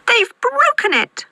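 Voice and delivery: very high squeal of a cub; squeaky baby bear. A narrator's reading. Baby Bear about his broken chair, high squeak. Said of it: "squeaked Baby Bear".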